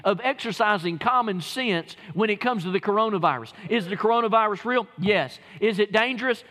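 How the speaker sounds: loud, fired-up sermon; preaching at full force. Real, not acted. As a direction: preach it hard to the congregation.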